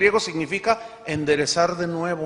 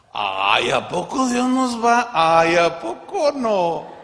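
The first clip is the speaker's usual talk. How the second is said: mock disbelief; 5 dB louder, slower than usual. Real, not acted. Mocking surprised disbelief.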